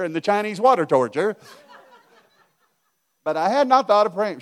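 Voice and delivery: loud and high while laughing at himself. Real, not acted. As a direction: laugh through the confession. Laughing at his own revenge fantasies.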